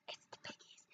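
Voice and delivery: breathy secret whisper; whispered aside. Acted. Storyteller telling the class the farmer is really the piggies, whispered aside. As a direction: let the listeners in on the secret in a whisper.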